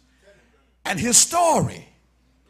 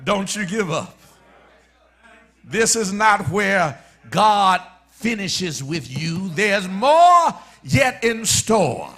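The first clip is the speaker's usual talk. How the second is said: preaching; 5 dB louder than usual. Acted. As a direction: stretch it out, then ring it. Preacher's rising declaration.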